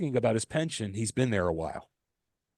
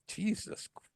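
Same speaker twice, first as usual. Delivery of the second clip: hushed whisper of disgust; 9 semitones higher, 9 dB quieter than usual. Retold or imitated. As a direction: breathe it out under your breath. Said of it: "I'm like".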